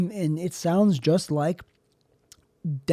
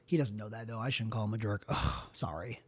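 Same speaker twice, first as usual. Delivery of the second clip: low, soft backtracking; sheepish aside; 7 semitones lower, 12 dB quieter than usual. Real, not acted. Regretting calling the critic a jerk, sheepish and quiet. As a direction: drop to a quiet, remorseful mumble. Backs off the insult.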